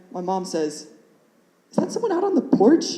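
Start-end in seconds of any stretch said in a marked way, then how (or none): 1.7-2.8 much higher, quick mom voice; mom's voice. Retold or imitated. Mom noticing a visitor while waiting, high and quick. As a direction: imitate a mother asking, pitched way up.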